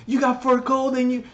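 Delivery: high, whiny mock voice; mocking teammates. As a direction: play the clueless teammate, nagging. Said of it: "People are like".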